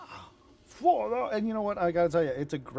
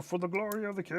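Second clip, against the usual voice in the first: mock war cry; 6 semitones higher, 4 dB quieter, faster than usual. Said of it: "or, you know".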